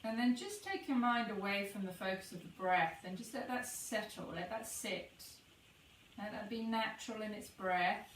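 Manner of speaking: soft, unhurried meditation voice with long pauses; guided meditation. Acted. Guide leading listeners to notice the breath pause, soft meditation voice. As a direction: ease them into the breath, gently.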